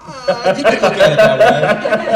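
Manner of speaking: laughing through the words; amused, laughing it off. Real, not acted. Laughing off nepotism question.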